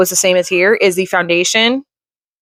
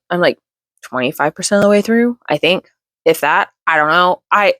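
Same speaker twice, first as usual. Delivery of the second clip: ordinary talk, nothing marked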